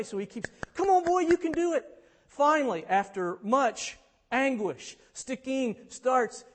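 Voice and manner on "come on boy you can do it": high, quick coaxing call; urging call. Acted. Muir urging the dog up the wall, calling out. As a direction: cheer a dog on, fast and high. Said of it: "So he starts calling out".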